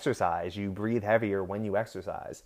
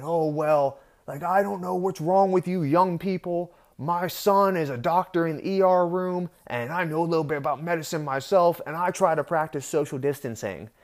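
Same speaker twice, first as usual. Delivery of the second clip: high-pitched imitation of a self-righteous older man; put-on, higher scolding voice; mocking clap-back; 7 semitones higher than usual. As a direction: mimic an older man lecturing young people. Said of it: "clapping back at me".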